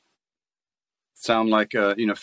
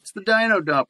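put-on much higher, excited child's voice; little kid voice; 11 semitones higher than usual. Showing how a kid's toy commercial should sound, in an excited high child's voice. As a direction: squeal it like an eager little kid. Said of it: "if it's a little kid".

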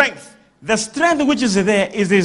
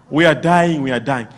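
mock crying; 7 semitones lower than usual. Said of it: "they're all crying".

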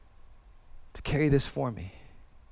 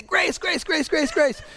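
put-on much higher, childlike chant of grace; squeaky kid begging; pleading child voice; 11 semitones higher, slower than usual. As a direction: plead like a small kid dodging a spanking.

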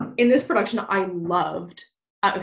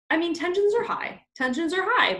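higher, mock-dramatic; higher playful "tensions are high"; 10 semitones higher than usual. Real, not acted. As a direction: pitch it up for effect.

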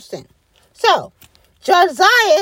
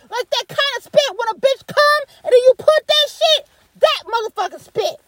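ordinary delivery

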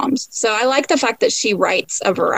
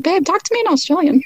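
higher, quicker playful plea; playful coaxing; 7 semitones higher, faster than usual. Retold or imitated. Wife begging husband for an accent, playful coaxing. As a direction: coax a partner, high and quick.

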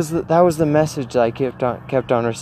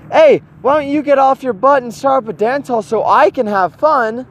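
mock accusing; 10 semitones higher than usual. Mock blaming others.

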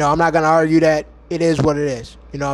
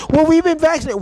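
higher mocking quote; mock vaccinated reply; 9 semitones higher than usual. Vlogger mimics vaccinated people in a higher mocking voice. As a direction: sneer it like a smug complainer.

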